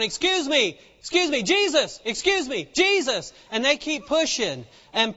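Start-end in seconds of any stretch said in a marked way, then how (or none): 0.0-3.3 mildly raised, insistent calling; mock holler, lifted on the name. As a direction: push through a crowd calling for attention. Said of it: "keep pressing and keep hollering".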